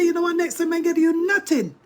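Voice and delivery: high, loud retold outburst; fed-up mother. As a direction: imitate a mom who has had enough. Retold mother snapping at son.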